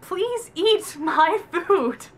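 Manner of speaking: hammed-up freaked-out begging; mock desperate plea. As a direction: drag out every word, begging. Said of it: "he's freaking out about it".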